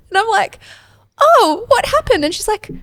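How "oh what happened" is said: mock surprise. Mum voices herself to daughter.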